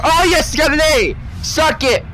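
high, loud triumphant cheer; loud gloating celebration; excited cheer. Real, not acted. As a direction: crow over the win. Celebrating a good grade.

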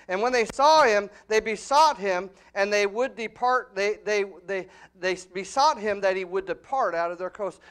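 full-force pulpit preaching. Acted. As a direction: preach it hard to the congregation. Preacher shouts the passage.

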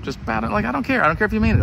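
high, whiny begging from the king; pleading king. Acted. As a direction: beg them to just bow, voice climbing up high. King pleading with three refusing men.

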